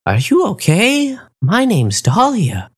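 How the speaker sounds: high, slow gentle flamingo voice; flamingo Dahlia. A narrator's reading. Flamingo Dahlia checking on the hurt wolf, high and slow. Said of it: "a flamingo asked me".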